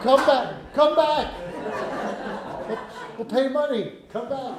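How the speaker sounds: high-pitched pleading call after someone leaving; mock calling out. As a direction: call them back, comically desperate. Retold calling after departing fiddler.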